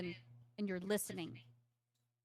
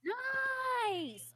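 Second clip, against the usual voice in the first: high, drawn-out cheer; excited cheer; 7 dB louder than usual. Real, not acted. Game master applauding a good roll, high excited cheer.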